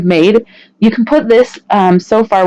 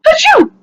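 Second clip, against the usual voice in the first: sneeze. Real, not acted. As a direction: sneeze it out sharply. Sneeze after slime.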